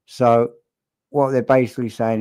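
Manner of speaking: ordinary delivery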